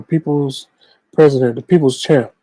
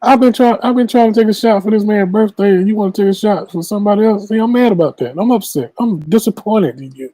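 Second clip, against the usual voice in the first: mock upset; 7 semitones higher, faster than usual. Mock upset at friend over shot.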